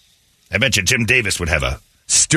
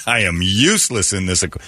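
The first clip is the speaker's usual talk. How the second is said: usual speaking voice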